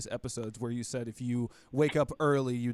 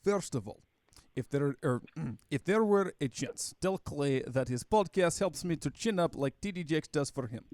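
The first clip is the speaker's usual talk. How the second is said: mock Russian-accented email reading. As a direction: read it out in a put-on Russian accent. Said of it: "try to read your stereotypical Russian accent".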